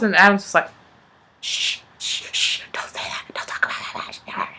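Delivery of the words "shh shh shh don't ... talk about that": urgent shushing whisper; hushed whisper. Acted. Little cousin hushing Rob about vampire powers, secretive whisper. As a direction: whisper it like a kid guarding a secret.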